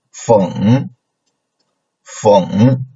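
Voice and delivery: falling-rising FENG; third tone. Shown as a demonstration. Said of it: "the third tone".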